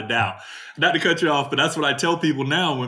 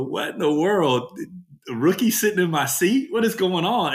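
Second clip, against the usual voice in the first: mock outrage; 6 semitones higher than usual. Player retelling finding a rookie in his seat, mock outraged. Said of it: "I go".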